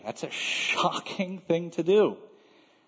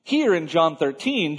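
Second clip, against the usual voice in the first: usual speaking voice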